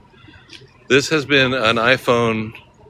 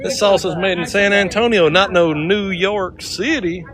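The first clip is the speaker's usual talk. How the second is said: salsa ad cowboy; 7 semitones higher than usual. Retold or imitated. Quoting the picante sauce ad.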